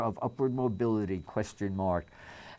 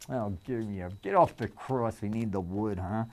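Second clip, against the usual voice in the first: ordinary talk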